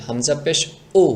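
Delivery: উ started with an abrupt glottal stop; knocked hamza উ. Shown as a demonstration. Teacher shows the hamza, উ with a knock. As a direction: hit উ cleanly without squeezing the throat. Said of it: "এটা টক্কর লাগবে".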